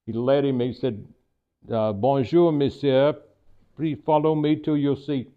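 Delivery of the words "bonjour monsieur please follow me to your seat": put-on amateur French accent, courteous head waiter; attempted French accent. Maitre d' greeting a diner, attempted French accent. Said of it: "Forgive my French attempt here".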